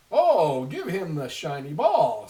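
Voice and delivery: a grandfather begging loudly to stop the crying; Sky Chief. Acted. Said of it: "said the Sky Chief".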